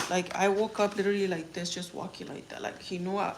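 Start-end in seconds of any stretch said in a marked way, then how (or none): none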